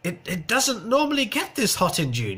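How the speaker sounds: high-pitched mocking quote of a script reader; mock weather presenter. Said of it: "she said".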